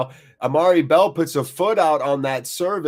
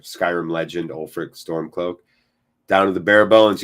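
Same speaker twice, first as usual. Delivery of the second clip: ordinary delivery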